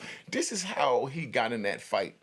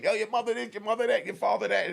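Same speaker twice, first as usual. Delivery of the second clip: high, louder mock taunting yell; imitated screaming; 10 semitones higher, 4 dB louder, faster than usual. Cell gangster screaming insults on the range. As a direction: rap insults at the door. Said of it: "and he's just screaming".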